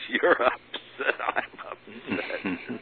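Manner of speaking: slow, drawn-out retort stressing upset; mock exasperation over the upset medium; exasperated echo. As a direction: throw her words back at her, slowly. Teller's exasperated reply to medium.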